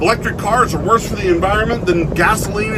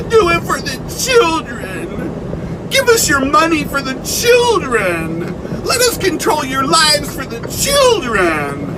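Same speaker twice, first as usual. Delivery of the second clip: higher, slower sneering parody; mocking slogan voice; 6 semitones higher than usual. Mocking activists who use the kid, sarcastic parody voice. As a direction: sneer at their "for the children" line.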